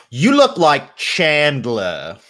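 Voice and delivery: put-on high, slow British party girl; British accent. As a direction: drawl it as a tipsy British girl. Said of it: "I should probably do accents".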